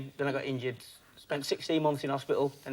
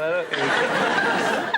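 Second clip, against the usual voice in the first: laughing; 6 dB louder, much slower than usual. Real, not acted. Laughing at his own joke.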